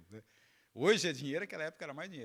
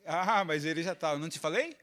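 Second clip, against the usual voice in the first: mother's I-told-you-so; 3 semitones higher, 9 dB louder than usual. Retold or imitated. Pastor gloating over the found wallet, mother's I-told-you-so. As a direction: crow it like a vindicated mum. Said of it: "Igual a mãe".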